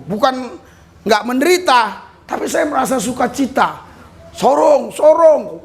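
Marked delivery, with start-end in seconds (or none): 4.4-5.7 a ship hand calling out the stop. Retold or imitated. Preacher retelling the ship calling out the port. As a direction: call the port name like a crewman announcing arrival.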